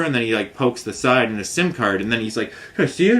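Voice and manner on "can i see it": high eager ask; eager question. Retold or imitated. Technician asking, retold.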